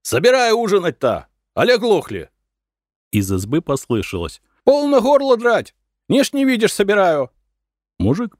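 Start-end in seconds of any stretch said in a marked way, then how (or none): 0.0-2.3 high, loud order; loud shout. A narrator's reading. Peasant husband ordering his wife to serve supper, loud shout. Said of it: "закричал громко". 4.6-7.3 very high, loud scolding; shrieked retort. A narrator's reading. Wife yelling back at her husband from the house, shrieked retort.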